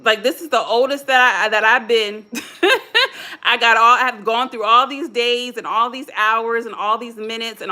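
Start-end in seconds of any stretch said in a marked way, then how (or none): 2.3-3.4 high rising chuckle; short laugh. Real, not acted. Speaker joking about being the oldest she's been, real laugh. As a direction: laugh at yourself lightly.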